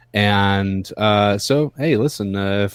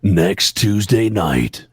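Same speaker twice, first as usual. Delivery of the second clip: movie trailer voice; much slower than usual. Shown as a demonstration. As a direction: announce it like a film trailer. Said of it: "I can't do it like you can".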